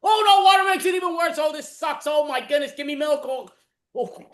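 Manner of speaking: high, loud, panicked yelping; frantic panic. Acted. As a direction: mouth burning from hot wings. Improv burning from hot wings.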